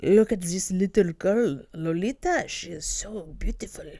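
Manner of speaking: creepy old man in a bad Russian accent, fading to a whisper; mock Russian accent, ending in a whisper. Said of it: "that's also not a Russian accent".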